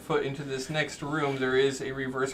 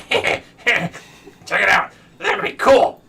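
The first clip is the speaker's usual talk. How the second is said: loud, high, manic skull voice; cackling creature jeering at intruders; maniacal taunting; 6 semitones higher, 11 dB louder, much slower than usual. Acted. Flame skull taunting the adventurers, loud maniacal voice. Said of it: "laughing maniacally as they taunt you".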